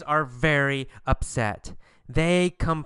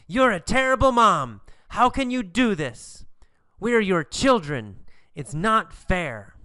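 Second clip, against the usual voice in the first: higher-pitched mock protest, easing off toward the end; ranting; 5 semitones higher than usual. Boys protest at being kicked out, ranting. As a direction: act out the complaining sons. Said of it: "So what do they say?".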